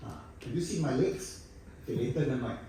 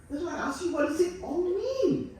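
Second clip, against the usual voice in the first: mock bewilderment; 12 semitones higher than usual. Comedian asks audience about art installations, high mock bewilderment. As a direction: plead it high in exaggerated bafflement.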